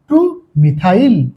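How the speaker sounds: TU MITHAIL (টু methyl) drawn out slowly, part by part; slow, deliberate টু methyl for learners; slow dictation. Shown as a demonstration. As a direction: say টু methyl slowly, with টু set apart. Teacher spells out name, then repeats.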